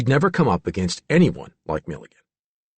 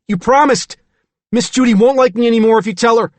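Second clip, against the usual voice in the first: put-on high, loud, fast pleading child; a scared boy begging her, quickly; frightened little boy; 12 semitones higher, 7 dB louder than usual. A narrator's reading.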